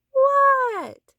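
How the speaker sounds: high, loud shouted reply; loud what. A narrator's reading. Froggy shouting back to his mother. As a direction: yell back from far off.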